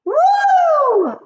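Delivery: very high loud whoop; cheering witches. Acted. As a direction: cheer wildly. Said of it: "cried the witches".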